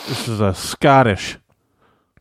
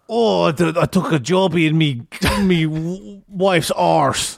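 higher, hammed-up fake Scottish; bad Scottish accent; 6 semitones higher, slower than usual. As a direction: play up a silly Scottish accent. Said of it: "It's like Irish, but more annoying".

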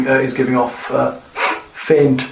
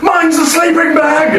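high, drawn-out eager cry; mock shout; 12 semitones higher, slower than usual. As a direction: shout it out, stretching the words. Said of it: "you shout".

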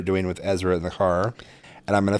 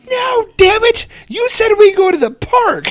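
much higher, loud mock tantrum shout; imitating angry kid; 8 dB louder than usual. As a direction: play the furious little boy yelling from the back seat. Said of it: "He's like".

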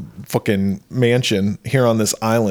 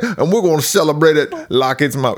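raised-pitch, hammed-up Southern accent; 4 semitones higher than usual. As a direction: play the grandpa in a broad Southern accent. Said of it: "he doesn't actually talk like that".

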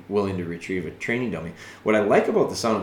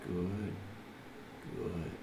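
soft, low, quiet "Good."; calm praise; 4 semitones lower, 17 dB quieter than usual. Shown as a demonstration. Trainer soothing the dog while it holds, softly. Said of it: "tell 'em how good they are. Calm 'em down".

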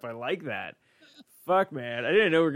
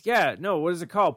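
ordinary delivery

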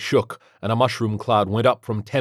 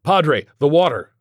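high, loud urgent cry; frantic shouted prompt; urgent call; 7 semitones higher, 6 dB louder than usual. A narrator's reading. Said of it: "Gesticulating wildly, I added".

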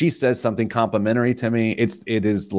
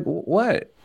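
much higher, startled stammer; stunned what; 10 semitones higher than usual. Retold or imitated. Husband reacting to his wife's complaint with high, stammering surprise. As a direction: caught completely off guard, mock-shocked. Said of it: "I was like".

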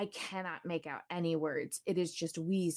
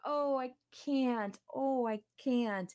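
higher, slow, drawn-out fake regret; mock excuse; 7 semitones higher, slower than usual. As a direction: whine it out like a flaky friend. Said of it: "they were like".

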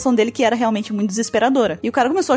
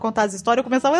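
ordinary talk, nothing marked

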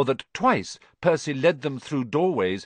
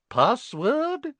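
much higher prim lady voice; Fat Lady portrait; 13 semitones higher than usual. A narrator's reading. Portrait guarding the common room asks for the password, high. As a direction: ask like a haughty painted lady. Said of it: "she said".

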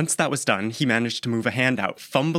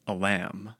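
ordinary delivery, nothing marked

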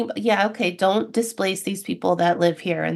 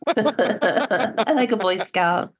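laughing; much slower than usual. Real, not acted. Laughing at Boy Scout joke.

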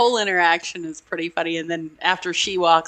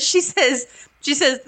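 ordinary delivery

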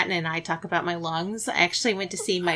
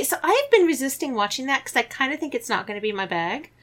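normal talking voice

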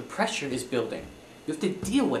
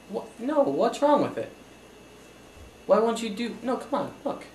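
higher, pleading disbelief; can't believe she said no; exasperated disbelief; 9 semitones higher than usual. Acted. Accountant pleads with client who rejects his plan, higher and exasperated.

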